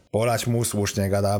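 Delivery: ordinary delivery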